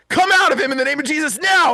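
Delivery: high, forceful acted exorcism cry; urgent command. Praying man casting a demon out, urgent command. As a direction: command it out, driving hard to the last word.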